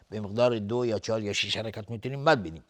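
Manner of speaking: ordinary talk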